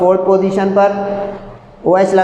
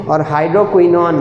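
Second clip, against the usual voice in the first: ordinary talk, nothing marked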